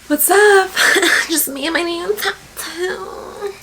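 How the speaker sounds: silly show-off voice. Pretend. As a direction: strike a pose and show off the new tattoo in a goofy voice. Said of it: "I just said it like that in that voice".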